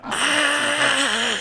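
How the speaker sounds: high, loud duck squawk. Shown as a demonstration. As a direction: push a sharp cartoon duck squawk. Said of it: "put a little more pressure".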